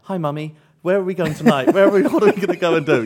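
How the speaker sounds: put-on high, loud well-spoken English boy; excited posh-accented greeting to mother; flip out of South East London accent. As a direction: switch to eager, proper English for Mummy. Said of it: "Without even thinking about it".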